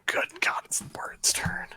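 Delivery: usual speaking voice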